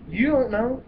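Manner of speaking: high, loud disbelief; incredulous retort. Retold or imitated. Mocking the doctors who admit ignorance, high and loud. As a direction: throw it back, incredulous.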